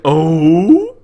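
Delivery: a loud put-on gasp of surprise; mock surprised oh. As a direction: imitate her startled Oh, hammed up. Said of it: "I'll bet that's how she said it".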